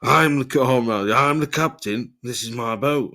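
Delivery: hammed-up skipper claiming his boat; mock boastful captain. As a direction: puff up as the self-declared captain of the boat. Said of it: "and be like".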